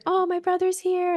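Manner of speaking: high-pitched, sustained mock cooing; excited child. Mother imitating her daughter meeting the new baby, high and excited. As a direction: imitate a thrilled, emotional little girl. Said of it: "like, so excited".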